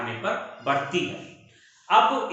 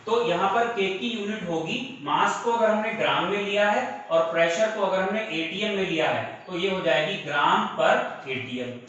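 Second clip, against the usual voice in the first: normal talking voice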